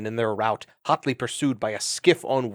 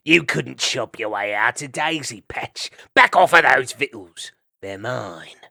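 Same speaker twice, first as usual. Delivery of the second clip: bullying ferret; 6 dB louder than usual. Acted. Ferret threatening weasel with blade.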